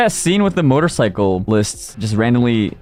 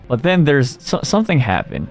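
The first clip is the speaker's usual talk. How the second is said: ordinary talk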